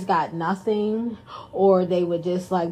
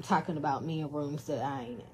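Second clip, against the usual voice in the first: ordinary delivery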